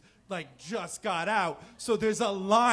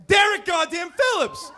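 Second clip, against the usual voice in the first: high, loud exclaim; comic telling the crowd who walked in, loud and high; outraged reveal; 12 semitones higher, 10 dB louder than usual. Real, not acted. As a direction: spit the name out like a punchline. Bully shows up at bar.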